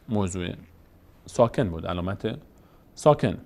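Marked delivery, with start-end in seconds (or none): none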